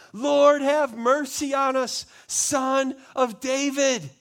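loud, high, drawn-out cry for mercy; desperate cry. Acted. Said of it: "they cried out all the more".

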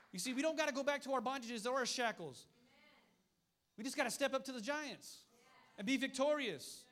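loud, rising preacher's cry; preaching at full force. Acted. Preacher rallying the church against fear, loud. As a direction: drive it home to the congregation.

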